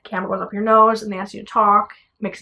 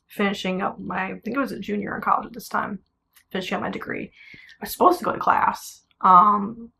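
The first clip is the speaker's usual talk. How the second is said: usual speaking voice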